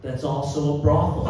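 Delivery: usual speaking voice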